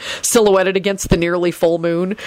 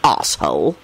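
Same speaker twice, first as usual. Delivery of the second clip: low grumbled insult; sour, low-pitched aside; grumbling; 12 semitones lower than usual. A narrator's reading. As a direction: drop it low, under a grumble. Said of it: "he grumbled".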